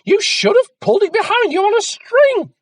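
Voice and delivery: very high, very loud shriek; yelled insult. A narrator's reading. Mother yelling at scratched Jack, shrieking. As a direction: scream the insult. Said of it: "yelled his mother".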